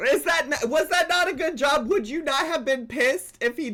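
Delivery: high, loud disbelieving protest; pushing back at the bartender story, loud and high; incredulous. Real, not acted. Co-host defending the bartender, high and loud.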